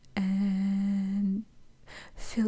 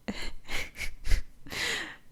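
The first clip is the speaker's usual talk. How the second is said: helpless laugh. Real, not acted. Can't stop laughing at show.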